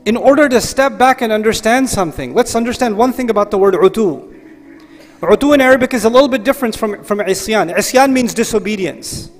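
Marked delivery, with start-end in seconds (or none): none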